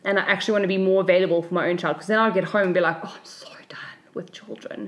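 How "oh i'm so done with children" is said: whispered, worn-out sigh of a line; tired whisper. Retold or imitated. Mom telling a friend, whispering how done she was. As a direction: breathe it out like you're drained. Exhausted teacher quote at home.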